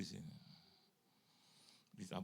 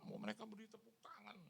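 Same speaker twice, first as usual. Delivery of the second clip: fast hushed whisper; whispered aside; 7 semitones higher, 13 dB quieter, faster than usual. Retold or imitated.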